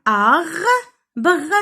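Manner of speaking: arbre as "ar", pause, "bre"; syllable by syllable. Shown as a demonstration. Teacher dividing words for children, arbre broken in two. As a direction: stretch arbre into two separate beats. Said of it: "نقسموا هاد الكلمات لي سيلاب".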